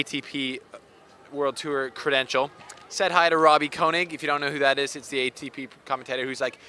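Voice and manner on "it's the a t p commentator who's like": ordinary talk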